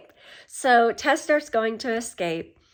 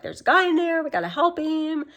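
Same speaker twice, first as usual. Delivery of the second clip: high-pitched, imitated urgent plea; 8 semitones higher than usual. Escaped woman begs the homeless man for help, mock urgent. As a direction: retell her plea in a raised, pressing pitch.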